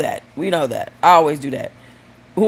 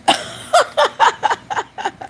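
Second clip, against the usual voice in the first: long, high peal of laughter; high cackle. Real, not acted. Joker laughs after duck joke, high cackle.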